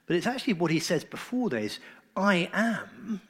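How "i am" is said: "I am" raised high and set apart; emphatic I am. Shown as a demonstration. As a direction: ring out "I am" as a solemn claim. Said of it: "It's very emphatic".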